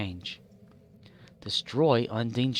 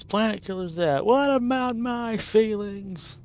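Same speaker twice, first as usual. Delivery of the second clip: whiny complaint; 10 semitones higher, 3 dB louder than usual. Pretend. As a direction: whine about being ignored.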